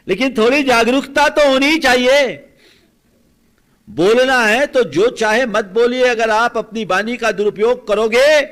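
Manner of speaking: loud, high-pitched warning; full-force preaching. Acted. Preacher warning against misuse of speech, forceful and loud. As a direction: thunder it out over the congregation.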